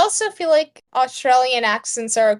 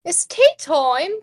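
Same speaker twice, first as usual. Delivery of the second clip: put-on Australian accent; 4 semitones higher than usual. Repeats Bluey quote in accent.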